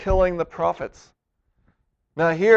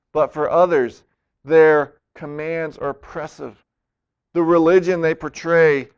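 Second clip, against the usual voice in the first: normal talking voice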